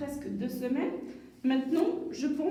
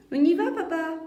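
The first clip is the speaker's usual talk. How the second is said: put-on child voice; 5 semitones higher, 7 dB louder, faster than usual. Child voice in dialogue.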